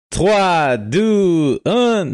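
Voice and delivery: high excited French countdown. Real, not acted. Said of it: "No, puta, no, en español".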